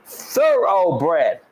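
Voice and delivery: THOROUGHBRED slowly, with THOR-OH-BRED all clear; pronunciation model. Shown as a demonstration. Co-host coaching his partner, slow clear THOROUGHBRED. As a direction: sound out every syllable of THOROUGHBRED. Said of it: "That's how you say it".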